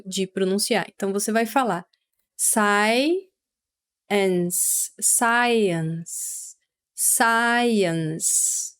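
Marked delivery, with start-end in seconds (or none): 2.3-8.7 science split sai-ence, slow and separated; science in two syllables. Shown as a demonstration. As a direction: break science into two clear beats. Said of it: "essa palavra em duas partes". (science em duas sílabas, bem separadas)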